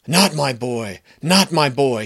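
put-on a frantic horse refusing to lose his boy; anguished horse. Traumatized horse cries out after kicking the wall, loud and desperate.

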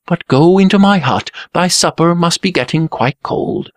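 lightly voiced anxious prince; Blue Prince voice. A narrator's reading. Blue Prince urging brother inside.

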